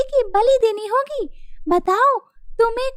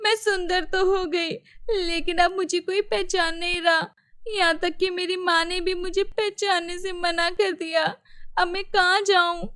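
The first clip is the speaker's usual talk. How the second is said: softer, tearful and broken; crying; 3 semitones lower, 5 dB quieter than usual. Acted. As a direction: weep out the loneliness quietly. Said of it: "तो अब क्यों रो रही हो?".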